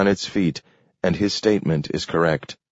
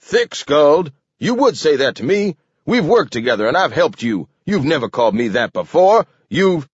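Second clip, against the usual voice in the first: high, outraged outburst; exploding in anger; 9 semitones higher, 4 dB louder than usual. A narrator's reading. King insulted by Webster, exploding. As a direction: splutter with wounded indignation. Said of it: "then exploded".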